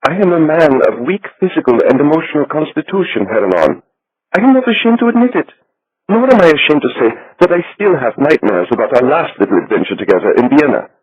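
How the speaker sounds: little Swiss banker. A narrator's reading. Narrator voices Becker the banker.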